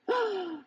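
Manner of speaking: sharp startled intake of breath; high surprised gasp; sudden high gasp. Acted. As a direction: gasp as feet leave the leaf. Feet lift off leaf.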